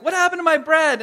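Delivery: high, loud, exasperated outcry; imitated exasperated complaint; Michelle. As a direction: call out in loud, high-pitched disbelief. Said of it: "I just hear Michelle".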